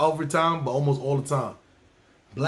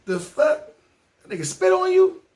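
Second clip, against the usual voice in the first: high, louder outraged surprise; shocked disbelief; 4 dB louder than usual. Retold or imitated. Passenger shocked the cabman got spat on, high outrage. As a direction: gasp it out in disbelief.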